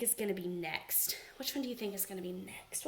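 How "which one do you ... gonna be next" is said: much softer, quicker near-whisper; softly asked again, like a secret; hushed repeat. Shown as a demonstration. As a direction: drop to a secret murmur. Repeats question, second time hushed.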